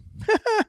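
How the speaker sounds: a quick high burst of laughter at his own joke; short laugh. Real, not acted. Host laughing at his own bird flu joke with a quick high laugh. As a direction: laugh once, high and brief.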